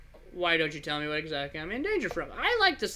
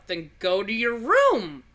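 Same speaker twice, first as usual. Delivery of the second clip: snapping order; 4 semitones higher, 9 dB louder, slower than usual. Acted. Babysitter loses patience with child.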